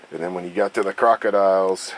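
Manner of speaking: ordinary delivery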